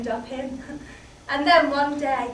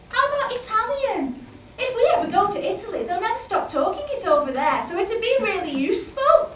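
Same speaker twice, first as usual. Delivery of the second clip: nagging wife; 7 semitones higher, 3 dB louder than usual. Acted. Wife demanding to husband, high-pitched.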